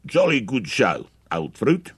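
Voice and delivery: high, loud, hammed-up British toff accent; mock his British accent, drawn out; mocking, over-the-top British accent; exaggerated British accent. A narrator's reading. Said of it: "Wally exaggerated Mike's accent".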